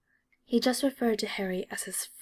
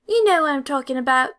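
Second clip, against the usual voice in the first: loud, higher angry snap; angrier retort; 5 semitones higher, 8 dB louder than usual. A narrator's reading. Angry redhead insists Draco knows, loud and angry. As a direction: spit it back at him. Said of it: "gets even angrier".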